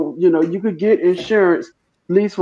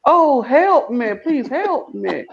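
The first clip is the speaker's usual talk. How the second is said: high, slow Southern drawl plea; Southern accent; 8 semitones higher, much slower than usual. Shown as a demonstration. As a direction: plead slowly in an exaggerated Southern accent. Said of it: "with a Southern accent, it would sound something like this".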